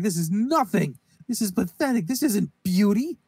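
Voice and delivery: imitated scornful critic; mock disgusted scorn. Acted scornful rejection of painting.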